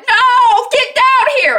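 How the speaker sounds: very high, loud acted scream; frantic yelling. Rebecca screaming for Jack to leave, frantic. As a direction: imitate a desperate shouted order. Voices wife screaming at husband.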